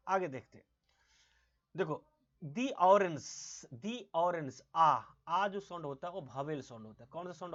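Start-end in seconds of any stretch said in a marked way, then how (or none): 2.4-3.7 दी pronounced "dee" before ऑरेंस. Shown as a demonstration. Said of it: "इसीलिए इस द को क्या बोलेंगे". 3.7-4.6 दी pronounced "dee" before ऑरेंस. Shown as a demonstration. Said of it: "दी बोलेंगे".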